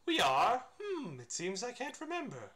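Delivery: lightly voiced Pooh, confused. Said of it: "the wrong inflection to put on that".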